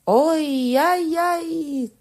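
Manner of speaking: very high, very slow stretched wail; milk the scream for the judge; hammed-up pain cry. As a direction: prisoner faking agony under torture. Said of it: "кричав він".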